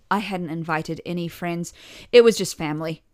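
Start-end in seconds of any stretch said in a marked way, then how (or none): none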